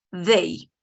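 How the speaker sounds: They said with the tongue showing between the teeth for th. Shown as a demonstration. Teacher modelling They with tongue between teeth. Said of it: "siempre tenemos que pronunciarla así".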